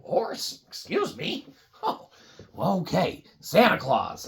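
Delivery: usual speaking voice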